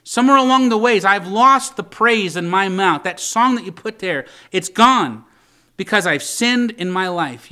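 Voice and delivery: loud, impassioned confession to God; a preacher voicing David's urgent prayer; pleading. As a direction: plead with God, building in force and easing at the end. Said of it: "it's pleading for the mercy of God".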